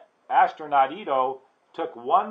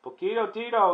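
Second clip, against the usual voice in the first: high, loud cry of recognition; exclaiming; 9 semitones higher, 5 dB louder, faster than usual. A narrator's reading. Traveller recognises his amigo, exclaiming high. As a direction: call out his name in delighted surprise. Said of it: "exclaimed Scapito".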